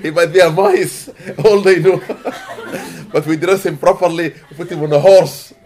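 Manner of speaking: loud, high, amused telling through laughter; real laughter breaking into the words; laughing while talking. Real, not acted. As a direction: crack up as you tell it. Laughs while explaining wedding boast.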